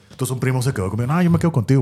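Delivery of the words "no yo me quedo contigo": higher, mock-scared cousin voice. Scared cousin insists on staying with him, mock fearful. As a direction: imitate a cousin too scared to go alone. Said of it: "él por miedoso".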